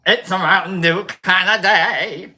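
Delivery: loud, slow, heavy warble; warbling impression. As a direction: wobble every drawn-out word like a bad impression. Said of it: "the warble in her voice".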